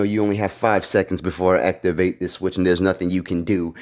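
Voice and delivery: low, menacing villain voice; villain threat. Retold or imitated. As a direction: play the bad guy gloating over his switch.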